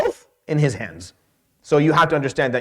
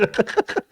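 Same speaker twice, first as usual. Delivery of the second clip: helpless little laugh; high, quiet burst of giggling; amused laugh; 7 semitones higher, 6 dB quieter than usual. Real, not acted. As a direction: laugh it off in disbelief. Laughs at the absurd number.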